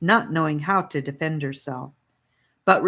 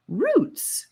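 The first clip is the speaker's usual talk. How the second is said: sharp high shout; a high shouted single word. A narrator's reading. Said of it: "Annika shouted at him".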